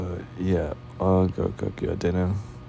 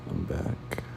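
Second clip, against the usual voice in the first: whispered; 8 dB quieter than usual. Real, not acted. Quiet return to partner.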